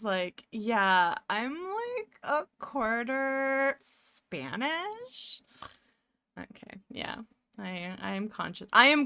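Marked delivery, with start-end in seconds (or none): 0.5-5.4 slow, drawn-out mock uptalk; mocking those people. Speaker mocks people claiming a quarter heritage, drawling mockery. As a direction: stretch each word, rising into a question. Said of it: "don't wanna be those".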